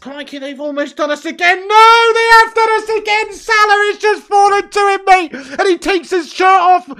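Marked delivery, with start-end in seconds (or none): 1.7-6.9 loud, high-pitched cry of dismay as the goal goes in; real anguished match-commentary yell; exasperated shout. Real, not acted. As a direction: burst into raised-voice despairing commentary.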